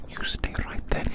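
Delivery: ordinary delivery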